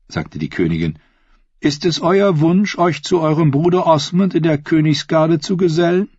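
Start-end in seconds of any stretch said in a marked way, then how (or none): 1.6-6.1 higher-pitched royal lady; inviting queen. Acted. Queen inviting Sir Osney to sit with her, high inviting voice.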